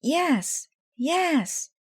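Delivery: high eager yes yes; shouted yes. A narrator's reading. The team urging Casey to ump, high eager shout. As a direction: beg him to say yes. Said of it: "shouted the Mighty Mites".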